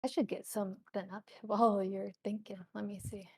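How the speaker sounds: half-whispered, under the breath; softly muttering to oneself; muttered aside. Real, not acted. Game master busies herself while the player thinks, muttered aside. GM talks to self while waiting.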